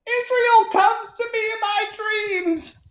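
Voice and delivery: slow, very high mocking falsetto; drawn-out, dreamy caricature far above your own voice; Haley Stevens. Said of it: "what about you, Haley Stevens?".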